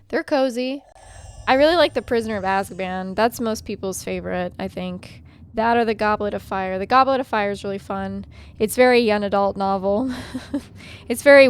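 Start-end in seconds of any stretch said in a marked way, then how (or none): none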